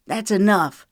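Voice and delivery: man shutting down the argument; sharp counter. Acted. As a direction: cut her off sharply. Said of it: "he countered sharply".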